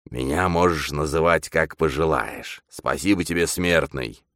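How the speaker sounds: possessed medium, condescending to a mortal; grandly growled demon speech; lordly demon. Acted. Demon speaking through medium.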